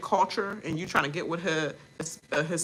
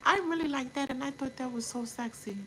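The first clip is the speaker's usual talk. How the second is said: higher, softer mock-seductive voice; mock flirty Amaya; 8 semitones higher, 11 dB quieter than usual. Amaya sweet-talking Brian on the side, higher and softer, mock-flirty. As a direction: coo it like she's flirting, hushed and high. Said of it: "so that she can be like".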